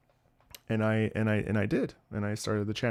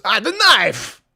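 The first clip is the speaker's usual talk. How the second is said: higher, louder imitation of an Italian grandfather yelling; grandfather's outburst; 8 semitones higher, 9 dB louder, much slower than usual. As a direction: mimic grandpa's outburst at the knife. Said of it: "and he goes".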